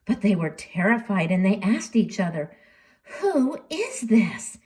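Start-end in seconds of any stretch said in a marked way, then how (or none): 3.2-4.6 slow, high whispered question; hushed frightened disciples; awed whisper. Acted. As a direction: whisper it in fearful wonder. Terrified disciples ask each other.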